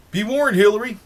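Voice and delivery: shouted teasing warning to Hillary; mock warning. Real, not acted. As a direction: throw the warning at her, half joking. Addresses Hillary directly, warning.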